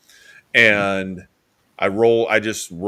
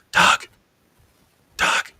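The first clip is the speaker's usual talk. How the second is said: imitated eerie whisper, unvoiced breath only; 3 dB louder than usual. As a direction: whisper it like someone unseen calling through a door. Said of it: "She was just whispering".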